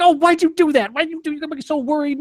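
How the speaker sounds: high, loud imitation of a fretting mom; worried mother. Mother scolds her son over the fake job news, high and fretting. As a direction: mimic his mother scolding him, high. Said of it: "My mother was like".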